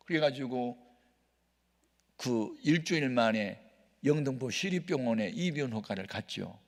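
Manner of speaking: ordinary talk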